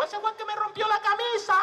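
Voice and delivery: piercing high accusation; acted yell. Man accuses the teller over his shirt, high acted yell. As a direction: cry it out high, stretching the end. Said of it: "Y gritó el tipo".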